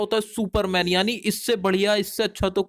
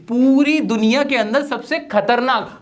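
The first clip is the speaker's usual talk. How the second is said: normal talking voice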